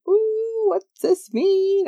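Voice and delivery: high, drawn-out curious squeal; wide-eyed wonder, sung-out and high; intrigued reaction. Retold or imitated. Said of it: "And I was like".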